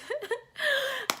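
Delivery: a high burst of laughter. Real, not acted. Laughing at her own wedding-planning stress, high laugh.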